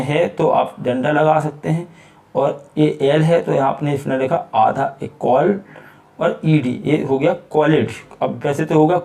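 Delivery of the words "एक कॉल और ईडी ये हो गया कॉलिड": usual speaking voice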